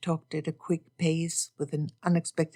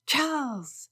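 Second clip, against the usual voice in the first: exclaimed; 8 semitones higher, 4 dB quieter than usual. Acted. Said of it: "Jane exclaimed".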